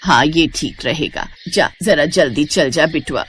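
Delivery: a hint of an elder village mother; lightly voiced village mother. Mother sending her son off, lightly voiced mother.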